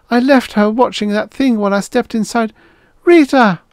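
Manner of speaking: high, loud distressed woman's voice; crying out. A narrator's reading. Mother calls for lost daughter, crying out. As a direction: cry out, peaking on the name. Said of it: "A woman was crying somewhere".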